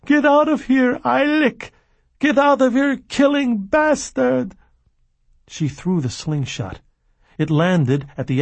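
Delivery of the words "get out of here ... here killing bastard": high, loud, furious scream. A narrator's reading. The furious girl drives Billy out, screaming high. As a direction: scream them away, pitched far up. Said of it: "she screamed".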